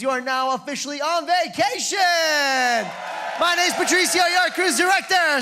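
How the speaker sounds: high, loud showman farewell; announcer sign-off. Real, not acted. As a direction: sell the goodbye big. Cruise director's big sign-off.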